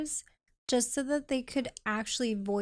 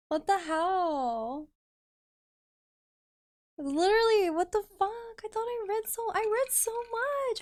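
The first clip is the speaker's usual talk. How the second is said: exasperated; 9 semitones higher than usual. Real, not acted. Exasperated at endless reading.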